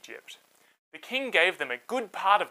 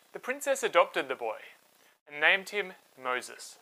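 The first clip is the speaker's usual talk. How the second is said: ordinary delivery, nothing marked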